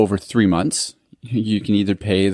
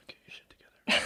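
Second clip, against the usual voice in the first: breathy teasing whisper; hissed under the breath; 13 dB quieter, much faster than usual. Real, not acted. Teasing friend after voice crack.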